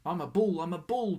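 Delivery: put-on loud, high child's bellow; loud boyish bellow. A narrator's reading. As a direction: roar it out proudly. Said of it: "he gave such a tremendous bellow".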